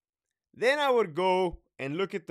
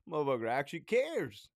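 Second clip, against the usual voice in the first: usual speaking voice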